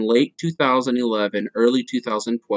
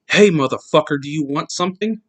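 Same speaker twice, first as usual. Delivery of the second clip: loud retold yell, pitched up; 5 semitones higher, 6 dB louder than usual. Husband yelling at the stalker's passing car, loud mock yell. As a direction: shout it down the alley at the creep. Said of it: "I yell".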